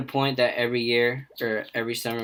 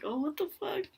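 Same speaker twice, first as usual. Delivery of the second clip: very high, hushed disbelief; mock shock; 7 dB quieter than usual. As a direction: squeak it out softly in alarm. Acting out shocked reaction to police threat.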